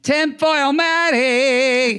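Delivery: high, slow, stretched-out fanfare; campy high-pitched hype call; drag queen announcement. Pretend. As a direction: trumpet your stage name, drawing out the last syllable. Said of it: "Get your own drag queen name".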